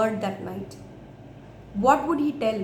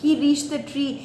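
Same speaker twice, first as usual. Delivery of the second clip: ordinary delivery, nothing marked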